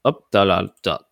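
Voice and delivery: usual speaking voice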